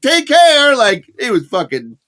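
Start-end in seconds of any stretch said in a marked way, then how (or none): 0.0-0.8 awkward farewell. Retold or imitated. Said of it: "I'm like".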